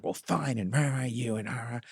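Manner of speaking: sulky anger. Retold or imitated. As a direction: imitate a pouting kid grumbling "rah, rah". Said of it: "like, all anger".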